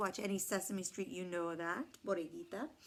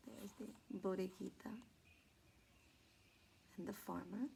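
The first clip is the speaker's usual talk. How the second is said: hushed aside; 14 dB quieter than usual. Real, not acted. Quiet aside showing picture.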